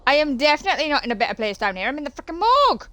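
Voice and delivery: loud, climbing to a high yelp; rising dismay. Real, not acted.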